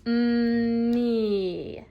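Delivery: ME stretched out, long held "Mm" gliding into "me"; said slow. Shown as a demonstration. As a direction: hold the M, then draw out "me". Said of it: "say it slow".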